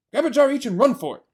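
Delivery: loud, fast and breathless with hurry; urgent order. Acted. Mister Fox urges the others to grab jars and run, loud and fast. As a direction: rush the others out.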